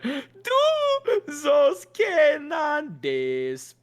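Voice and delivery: high, very slow shouted falsetto; falsetto scream. Retold or imitated. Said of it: "falsetto screaming".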